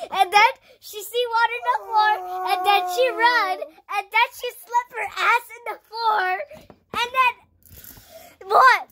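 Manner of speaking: high, loud mocking story; gleeful, high-pitched trash talk; excited taunting. Taunting rival with mom joke.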